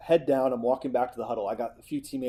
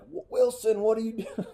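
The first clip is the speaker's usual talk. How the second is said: high mock coach yell at his quarterback; 9 semitones higher than usual. Coach yells at the quarterback after the fumble, high and sharp. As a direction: shout it up high like a fed-up coach. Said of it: "We yell".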